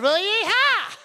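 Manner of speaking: high, loud showman Yeehaw; driver's yeehaw. Retold or imitated. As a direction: sign off with a big whoop. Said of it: "she obviously replied".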